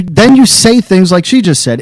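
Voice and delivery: ordinary talk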